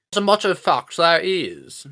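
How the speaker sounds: put-on high, loud, silly British accent; stupid British accent. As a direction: pipe up in a hammed-up British voice. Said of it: "in that stupid British accent".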